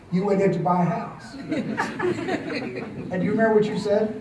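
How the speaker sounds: words broken by laughter, slowing; laughing. Real, not acted. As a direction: laugh through the question as the irony sinks in. Confronting the thief, laughing.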